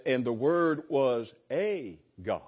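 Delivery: "a" drawn out as a long "ay" before "God"; stress on "a". Acted. Teacher quoting their Bible, stress on "a". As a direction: lean slowly on "a", said "ay". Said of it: "put an A before it".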